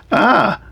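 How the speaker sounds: short, high-pitched mock scream "Ah!"; puppet's pretend fright, a quick high "Ah!"; startled yelp. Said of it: "all of a sudden he sees a snake".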